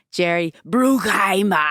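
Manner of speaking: drawn-out, hammed-up "Bruckheimer"; exaggerated mispronunciation. Guest showing how she always said the name, exaggerated mispronunciation. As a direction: linger on "Bruckheimer", dropping the final r. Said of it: "I would always say".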